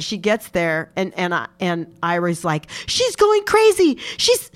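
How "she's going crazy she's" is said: panicked report. Retold or imitated. Ira panicking on the phone.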